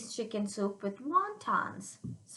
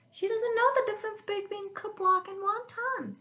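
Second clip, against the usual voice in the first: mock complaining grandma; 9 semitones higher than usual.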